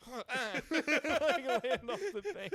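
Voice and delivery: words squeezed out through laughter; laughing. Real, not acted. Cracking up mid story.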